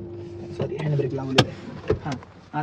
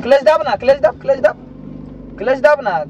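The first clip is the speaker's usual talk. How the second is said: raised, higher voice insisting on the clutch; urgent raised instruction to a panicking learner driver; urgent raised command; 5 semitones higher, 3 dB louder than usual. Real, not acted. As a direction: snap the learner into pressing the clutch. Learner forgets clutch, instructor urges.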